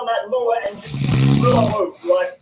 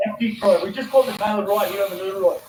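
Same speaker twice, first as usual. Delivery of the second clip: ordinary talk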